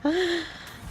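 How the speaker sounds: quick high-pitched giggle. Real, not acted. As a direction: giggle with delight. Delighted by spell.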